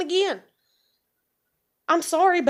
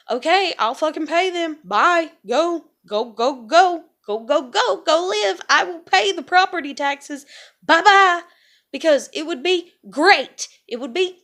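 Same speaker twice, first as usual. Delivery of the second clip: louder, higher mocking shooing; sarcastic send-off; 4 semitones higher, 5 dB louder than usual. Telling grandmother to go, loud and sarcastic. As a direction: wave her off with scorn.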